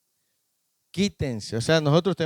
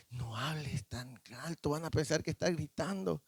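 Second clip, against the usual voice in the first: wife's hushed warning; 2 semitones lower, 13 dB quieter than usual. Retold or imitated. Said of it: "Mi esposa me dice".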